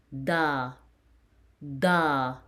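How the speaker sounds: "Da" with a drawn-out open ah; "Da" held, like a letter drill; long A syllable. Shown as a demonstration. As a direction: teach "Da" slowly as one held syllable. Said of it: "you add A to it".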